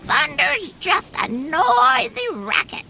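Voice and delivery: very high, quacking duck voice; loud quacky duck. A narrator's reading. Duck scoffs at the thunder, high quacking. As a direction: quack it out defiantly. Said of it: "loud as he could quack it".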